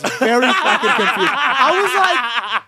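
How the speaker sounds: laughing through the words, loud. Real, not acted. Cohost admitting he misheard, laughing loudly. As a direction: crack up while you confess it.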